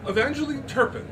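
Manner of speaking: grand announcement. Acted. Miss Turpin introducing herself to Lizzie, grandly. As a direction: announce your name like a society lady. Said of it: "she announced".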